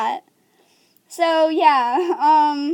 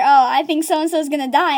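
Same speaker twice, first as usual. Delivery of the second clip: usual speaking voice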